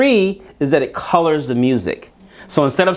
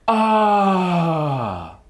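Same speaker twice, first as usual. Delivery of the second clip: sung sigh. Shown as a demonstration. Teacher demonstrates a sighing sound for the class to copy, sung ah. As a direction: open sighing tone on ah. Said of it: "So it'll be like this".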